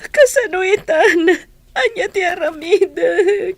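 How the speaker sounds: slow, loud, crying lament; desperate weeping question; broken sobbing plea. Acted. Grieving daughter asks what now.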